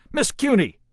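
loud, high call across the bridge; calling out a command. A narrator's reading. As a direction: snap her name as an order. Captain calls gunner.

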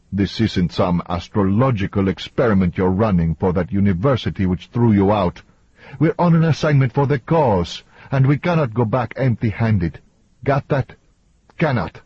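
lightly acted angry lecture; heated, overbearing man. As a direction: berate a colleague, rising in pitch. Voices Antonio berating his colleague.

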